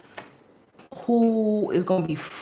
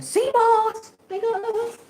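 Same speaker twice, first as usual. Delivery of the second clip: high squeaky joking voice; playful high aside; slower than usual. Real, not acted. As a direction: toss it off up high.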